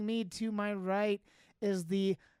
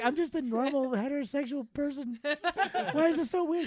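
laughing imitation; 6 semitones higher than usual. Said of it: "She's like".

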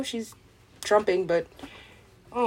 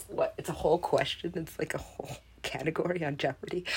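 ordinary talk, nothing marked

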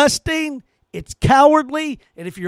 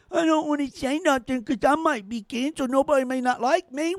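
softer, put-on timid voice mocking cowards; 2 semitones higher, 7 dB quieter, faster than usual. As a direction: sneer as a scared man. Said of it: "today's men are cowards".

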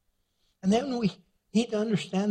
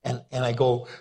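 ordinary delivery

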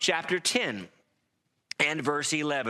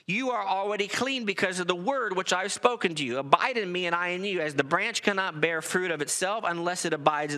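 raised, ringing scripture reading; 4 semitones higher, faster than usual. Acted. Preacher reading Jesus's words to the congregation, raised and ringing. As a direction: read the verses out like a pulpit proclamation. Preacher reads John fifteen aloud.

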